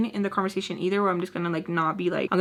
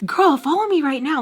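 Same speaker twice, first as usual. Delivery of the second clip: higher, louder gushing order; mock eager demand; 6 semitones higher, 5 dB louder than usual. People seeing her page, mock eager demand. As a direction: act suddenly keen and insistent. Acting out people's changed attitude.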